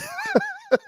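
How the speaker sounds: sharp high burst of laughter. Real, not acted. Host laughing at his friend's pathetic story, high laugh. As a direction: crack up at the punchline. Laughing after "Isn't that great?.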